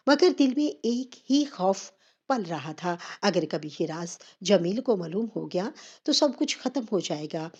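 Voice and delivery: plain reading voice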